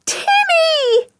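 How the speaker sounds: very high, drawn-out yell for help; shouted call. A narrator's reading. George tied up, shouting for her dog. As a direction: shout it high to carry far away. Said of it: "shouted George".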